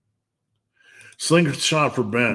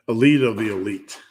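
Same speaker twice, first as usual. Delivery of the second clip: ordinary delivery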